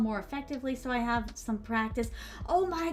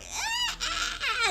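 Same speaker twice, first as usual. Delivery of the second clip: mock crying wail, much higher; a hammed-up sobbing ah, pitched way up. Girl wailing at the prince, a mock crying ah. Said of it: "And she's like".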